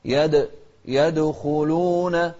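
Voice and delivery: slow melodic tajweed chant; Quran recitation. Shown as a demonstration. Teacher reciting Quran to students, slow melodic chant.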